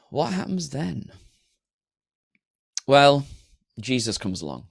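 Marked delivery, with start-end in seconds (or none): none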